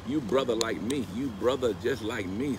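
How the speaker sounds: ordinary talk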